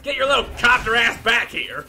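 high furious yelling at enemies; angry yelling. Acted. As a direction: scream threats at the fleeing enemy. Yelling at enemy copters.

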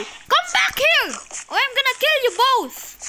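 high, loud villain shout; mock angry threat. Game villain to fleeing pigs, mock-angry shout. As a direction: yell a hammed-up threat at escaping prey.

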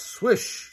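breathy sliding sound effect; swishing slip. A narrator's reading. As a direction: make the slide whistle past. Slipping sound in story.

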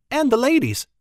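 put-on high, prim young lady voice; a lady announcing her tray with pride; prim Annis. Annis serving ale, prim and high.

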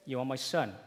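ordinary talk

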